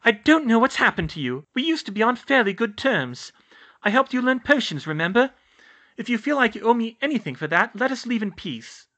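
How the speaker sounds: high, loud, urgent reasoning; insistent young witch. A narrator's reading. Hermione reasons with Filch.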